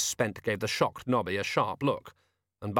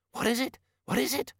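excited. Acted. Colin excited by the find.

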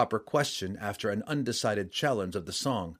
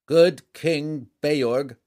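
loud, slow, higher proclamation; formal herald; 5 semitones higher, 8 dB louder, much slower than usual. A narrator's reading. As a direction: announce it to a hall as herald. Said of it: "the standard-bearer began".

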